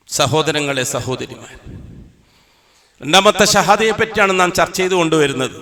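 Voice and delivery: ordinary talk, nothing marked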